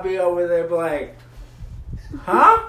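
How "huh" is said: loud, high "come again?"; mock hard-of-hearing. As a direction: call it out as if you can't hear. Acting out being hard of hearing.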